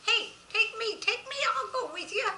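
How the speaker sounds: high, loud, excited goat voice; eager little goat. A narrator's reading. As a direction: pipe up loudly like a pleading little animal. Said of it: "It almost seemed like she was saying".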